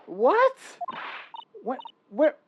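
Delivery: very high shocked what. Acted. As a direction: jolt out a startled what. Shocked at murder news.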